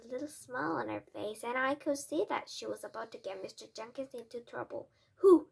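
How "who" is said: high, very loud demand; sharp cry. A narrator's reading. Father demands the culprit, crying out loudly. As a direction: burst out with the question. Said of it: "he cried".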